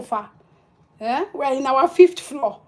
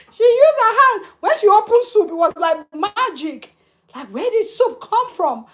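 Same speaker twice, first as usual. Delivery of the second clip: excited retelling; 7 semitones higher, 6 dB louder than usual. Real, not acted. Amazed at her mother's reaction.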